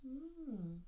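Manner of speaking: ordinary delivery, nothing marked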